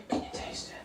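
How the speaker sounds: whispered, barely audible question; hushed whisper. Retold or imitated. Dinner hosts check on their secret healthy food, whispering.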